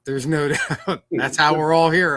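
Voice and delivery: chuckling through the words; laughing. Real, not acted. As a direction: laugh while you talk. Joking about the nickname, laughing.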